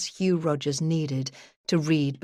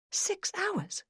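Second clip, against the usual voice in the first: "Six hours?" shot up much higher, soft, falling on "hours"; incredulous thought; 8 dB quieter than usual. A narrator's reading. Detective hearing the judge's short extension, incredulous. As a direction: disbelief at how little time is left. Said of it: "I thought to myself".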